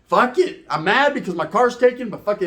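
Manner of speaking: normal talking voice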